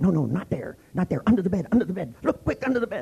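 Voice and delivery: rising high, urgent repeats; hammed-up frantic pointing; mock alarm. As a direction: pretend panic to play along. Playing along with paranoia.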